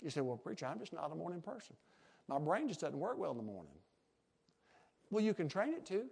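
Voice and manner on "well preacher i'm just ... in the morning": soft, quick imitation of an excuse; mock excuse. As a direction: plead it quietly, as a lightly acted congregant. Voices a listener's excuse.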